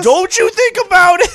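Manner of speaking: very high, loud joking threat; mock shout. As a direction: yell a playful warning at burglars. Joins the joking warning shout.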